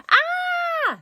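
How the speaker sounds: loud shrill scream; high scream. A narrator's reading. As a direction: shriek in terror. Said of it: "screamed Raj".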